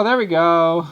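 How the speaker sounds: loud triumphant there we go; relieved cheer. Real, not acted. At the drawing as it finally works, loud and relieved. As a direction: cheer the stroke that finally worked.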